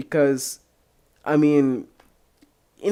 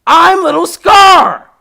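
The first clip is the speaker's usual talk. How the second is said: very high, loud, slow shout; very high excited shout; mock listener greeting; 18 dB louder, much slower than usual. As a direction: shout it like an eager fan. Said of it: "text me back".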